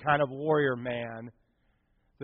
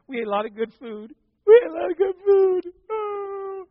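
high, loud mock wail; imitated crying; 9 dB louder than usual. Girl testifying about the food in church, mock crying. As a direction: break into hammed-up sobbing mid-testimony. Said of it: "she started crying".

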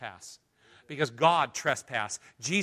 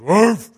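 big dog woof; 5 semitones higher, 14 dB louder than usual. Retold or imitated. Preacher imitating his own dog barking once. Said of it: "one of those dogs that goes".

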